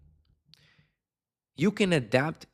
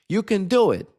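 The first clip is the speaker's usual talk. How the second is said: energetic tone; 8 semitones higher, 5 dB louder than usual. Shown as a demonstration. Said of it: "If the conversation demands you speaking like this".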